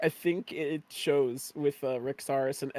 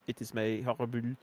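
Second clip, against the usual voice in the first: usual speaking voice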